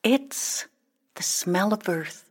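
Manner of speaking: soft, halting wonder; hushed reminiscence. Acted. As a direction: recall it quietly, with a pause. Hushed remembering of home.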